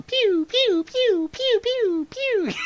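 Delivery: very high-pitched pew-pew shots; imitated sci-fi gunfire in falsetto; toy laser gun noises. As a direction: squeal blaster shots like a kid at play. Child playing with toy gun.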